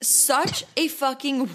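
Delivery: talking while eating olives; mouth full. Real, not acted. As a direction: talk round a mouthful. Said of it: "I'm eating too many olives".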